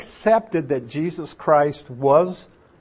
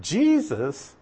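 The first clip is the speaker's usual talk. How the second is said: ordinary delivery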